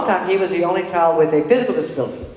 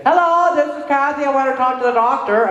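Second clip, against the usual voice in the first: loud mock call; 9 semitones higher, 5 dB louder than usual. Shown as a demonstration. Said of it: "I keep my voice down".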